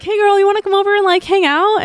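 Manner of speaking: high, fast, sugary invite; mock innocent invitation; sweet coaxing. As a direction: lure a friend over in a sing-song high voice. Sweetly luring friend over.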